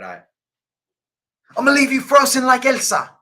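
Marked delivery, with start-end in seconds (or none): 1.6-3.2 high, loud raging shout, put on; mock raging. Streamer on request doing the Elsa line, mock raging. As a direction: yell the threat in a hammed-up rage. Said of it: "like Elsa raging".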